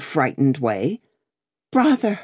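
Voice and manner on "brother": tearful cry. A narrator's reading. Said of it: "cried Miss Pross, bursting into tears".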